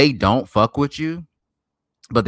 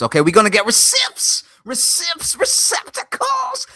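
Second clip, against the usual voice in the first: loud, climbing into very high squeals; hyped celebration; 14 semitones higher, 4 dB louder than usual. Real, not acted. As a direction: whoop it up, pitch soaring on each word.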